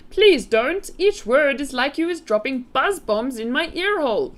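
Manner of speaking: high, loud pleading giant; pained cry. A narrator's reading. BFG begging Sophie to stop, pained cry. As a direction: wail as if each word hurts. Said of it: "cried the poor B F G".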